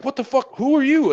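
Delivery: very high, loud alarmed cry; yelling at the creature under the sandbags; terrified shout. Retold or imitated. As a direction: shout at it in panic. Confronting the creature.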